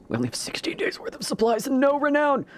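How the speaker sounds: high, urgent stage whisper; mock-panicked whisper. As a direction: hiss the bad news in a whisper. Reading supply warning.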